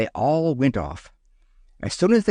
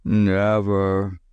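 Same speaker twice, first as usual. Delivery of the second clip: sleepy; 3 semitones lower than usual. A narrator's reading. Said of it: "said Teddy sleepily".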